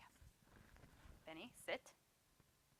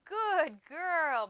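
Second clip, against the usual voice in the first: high, much louder praise for the dog; excited praise; 7 semitones higher, 13 dB louder than usual. Real, not acted. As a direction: reward her with a happy cheer. Praising dog after success.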